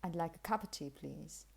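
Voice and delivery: "cup of tea" as "cuppa tea"; informal reduction. Shown as a demonstration. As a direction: drop the v, run it together. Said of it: "Informally, you might find this".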